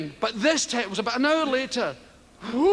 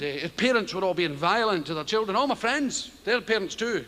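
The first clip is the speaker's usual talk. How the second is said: ordinary talk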